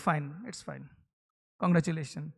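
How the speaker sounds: low, soft, grudging "Fine, it's fine"; reluctant congratulations. Shown as a demonstration. Teacher showing someone forced to congratulate, grudging. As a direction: congratulate without meaning it. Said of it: "मन ही नहीं कर रहा है वो congratulate करने का".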